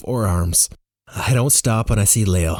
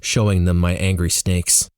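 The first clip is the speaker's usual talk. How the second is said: usual speaking voice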